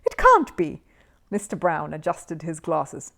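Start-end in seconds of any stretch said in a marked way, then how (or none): 0.0-0.8 high loud cry of disbelief. Acted. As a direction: refuse to believe it, up high. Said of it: "exclaimed Missus Bird".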